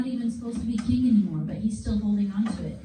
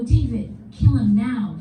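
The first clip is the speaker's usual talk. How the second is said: louder, pressing him to strike now; urgent loud urging; 8 dB louder than usual. Retold or imitated. David's men urging him to kill Saul, loud and urgent. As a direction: egg him on, forcefully.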